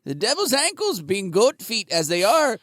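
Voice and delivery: high, hammed-up Irish storyteller mocking the devil; high mock Irish brogue, teasing; Irish accent. Teller mocks the devil stuck in a tree, high mock Irish accent. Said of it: "cannot tell the difference between Scottish and Irish".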